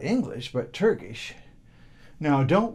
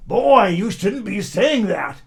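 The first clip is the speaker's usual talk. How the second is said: louder, slower angry shout, voice pitched up; angry yell; 6 semitones higher, 8 dB louder than usual. Acted. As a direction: yell it up at the boy, cross and slow. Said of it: "he yelled up angrily at Mustafa".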